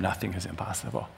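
ordinary delivery

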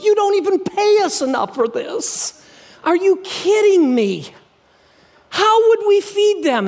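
high, panicked protest; Philip freaking out. Acted. Philip panicking at Jesus over feeding the crowd, high and frantic. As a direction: squeal it out like a disciple in a panic. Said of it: "Philip freaks".

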